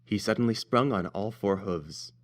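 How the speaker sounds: plain reading voice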